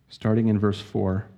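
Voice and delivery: ordinary delivery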